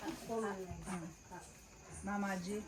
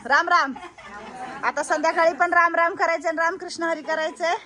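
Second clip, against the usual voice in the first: calling out the greeting, higher and louder; 5 semitones higher, 5 dB louder than usual. Real, not acted. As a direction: call out so the whole room hears. Greeting the elders out loud.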